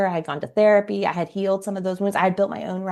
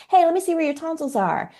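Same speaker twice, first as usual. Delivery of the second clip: put-on much higher, coaxing voice for a little girl; sing-song to a child; 10 semitones higher than usual. Friend talking to a three-year-old, high and coaxing. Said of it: "and she said".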